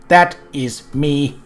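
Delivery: ordinary talk, nothing marked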